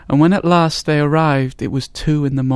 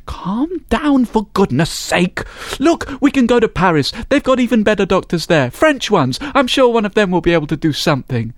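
high, placating husband voice; husband calming his wife, higher; soothing husband; 8 semitones higher than usual. A narrator's reading. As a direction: talk her down, hurried.

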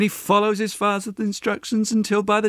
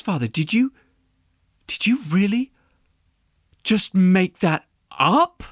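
halting, disbelieving question; mildly stunned daughter, slowing toward the end; shocked. A narrator's reading. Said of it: "she's shocked".

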